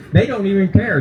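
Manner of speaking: ordinary delivery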